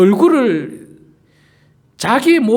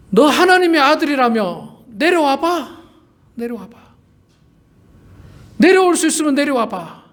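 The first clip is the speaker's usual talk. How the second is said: jeering dare; 7 semitones higher than usual. Retold or imitated. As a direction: dare him to come down, jeering. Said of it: "예수님을 조롱하는 거예요".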